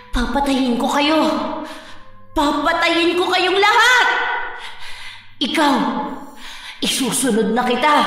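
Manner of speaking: menacing threat; very angry. Acted. Crazed woman vows to kill them all and points at Kara, very angry. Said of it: "Galit na galit siya".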